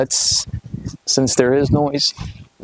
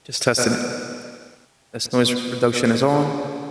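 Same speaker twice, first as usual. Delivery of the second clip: processed, filtered mic voice; noise reduction artifacts. Real, not acted. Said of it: "I'll turn on the noise reduction".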